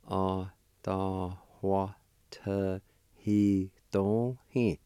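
Adawatehidohi drawn out very slowly, syllable by syllable; teacher slowing Adawatehidohi right down; slow, broken into syllables. Shown as a demonstration. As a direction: stretch each syllable of Adawatehidohi for the learner. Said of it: "It's pronounced like this".

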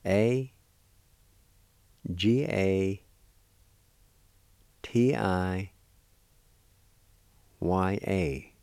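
letters in measured groups, well spaced; letter-by-letter spelling. Shown as a demonstration. Teacher spelling a word for learners, letter by letter. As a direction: spell it out slowly with long pauses. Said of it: "It's spelled like this".

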